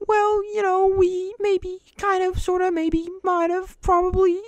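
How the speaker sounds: very high squeaky hedging voice; sheepish hedging. Retold or imitated. Students dodging the instructor's question, high sheepish squeak. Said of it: "all sheepish".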